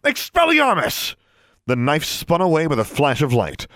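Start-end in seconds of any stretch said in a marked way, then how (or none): 0.0-1.2 high, drawn-out spell cry; shouted spell. A narrator's reading. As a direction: cast it hard with the voice way up. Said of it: "she shouted, pointing her wand".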